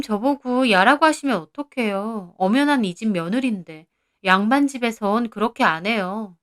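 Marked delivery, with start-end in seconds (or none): none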